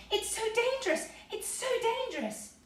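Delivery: high, wailing repeated cry; crying out in fright. A narrator's reading. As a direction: wail it as you scurry away. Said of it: "scuttled off crying".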